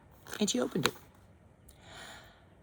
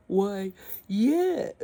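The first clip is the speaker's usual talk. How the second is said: proud dragon; 7 semitones higher, 6 dB louder than usual. Acted. Dragon boasting again.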